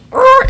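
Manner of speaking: high angry arr cry; mock the driver's roar; angry growl. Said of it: "And the guy goes".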